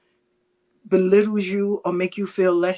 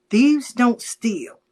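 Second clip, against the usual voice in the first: slow emphasis; 3 dB louder, much slower than usual. Real, not acted. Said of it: "advice to viewers".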